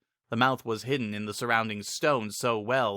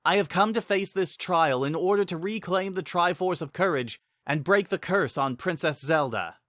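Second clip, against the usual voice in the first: higher and bold, declaring his quest; young hero's resolute declaration, pitched up; Link the hero; 7 semitones higher than usual. Acted. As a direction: state the mission proudly. Narrator voices Link.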